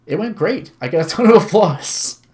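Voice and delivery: excited boast. Acted. Visitor reports interview to Mister Mino, excited boast. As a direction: proudly burst out with good news.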